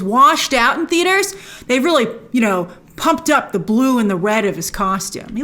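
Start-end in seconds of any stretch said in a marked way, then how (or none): none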